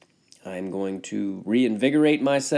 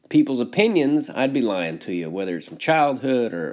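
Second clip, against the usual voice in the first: ordinary talk, nothing marked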